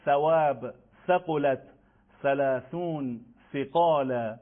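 each word of "ثواب، ثقلت، ثلاثون، ثقال" begins with th; measured model words, "ثواب، ثقلت، ثلاثون، ثقال", tongue between teeth; "ثواب، ثقلت، ثلاثون، ثقال" spaced out, Arabic th for ث; Arabic tha examples. Shown as a demonstration. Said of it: "مثل حروف".

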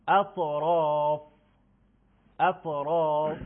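اطراف slow and loud, with a heavy ط; recitation model. Shown as a demonstration. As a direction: draw out اطراف carefully. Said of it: "کلمهٔ اول".

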